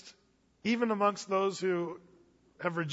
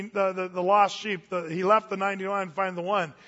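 ordinary talk, nothing marked

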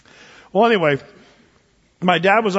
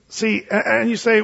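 normal talking voice

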